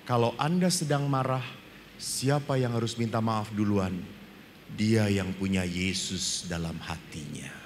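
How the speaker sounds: ordinary talk, nothing marked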